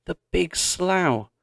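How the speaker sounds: sudden high exclamation; realising the danger at once; exclaimed. A narrator's reading. Pa realising Grace may be in the slough, alarmed exclamation. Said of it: "he exclaimed".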